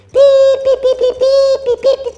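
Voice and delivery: high, loud excited beeps; loud bird beeps. Acted. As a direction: beep like a thrilled bird.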